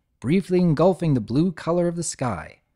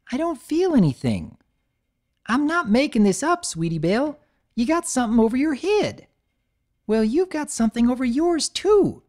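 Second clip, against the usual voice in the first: bickering fillies; 11 semitones higher than usual. Acted. Fillies arguing about halos.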